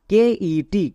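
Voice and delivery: slow, drawn-out spelling of কেইটি; teacher spells the next syllable slowly; spelling out letters. Shown as a demonstration. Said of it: "cat".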